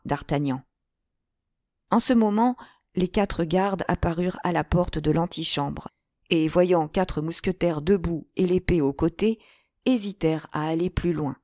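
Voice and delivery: ordinary delivery, nothing marked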